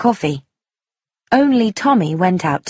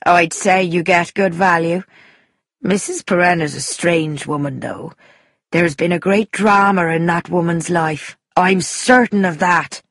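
put-on Irish accent, knowing and emphatic; Irish countrywoman gossiping. Guest gossiping about the landlady, knowing Irish voice. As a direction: confide gossip like an opinionated Irishwoman.

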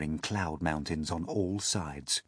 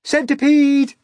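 high, loud delight; thrilled, full-voiced cries; excited shouting; 11 dB louder than usual. A narrator's reading. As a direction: shout in rapture, then call out. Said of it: "they shouted".

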